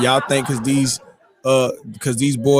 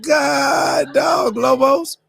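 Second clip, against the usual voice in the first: high, shouted dismay; shocked exclaim; 12 semitones higher, much slower than usual. Retold or imitated. Reacting to the twist, a high shocked shout. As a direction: cry out in shock. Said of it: "I'm like".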